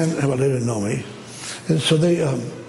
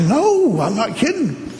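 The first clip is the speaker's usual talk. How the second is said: emphatic insistence; 10 semitones higher, slower than usual. Retold or imitated. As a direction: insist to someone who doubts you. Insisting to a disbelieving pastor.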